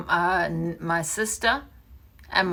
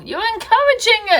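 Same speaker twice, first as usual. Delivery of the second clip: shout; 9 dB louder, faster than usual. Real, not acted. Shouted accusation at Pearl.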